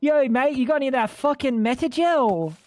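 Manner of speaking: a hint of Australian mock bloke asking for Metagel; mock Aussie bloke. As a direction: play a dopey Australian asking for food. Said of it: "he's gonna walk around sounding like a fucking idiot".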